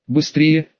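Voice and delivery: ordinary delivery, nothing marked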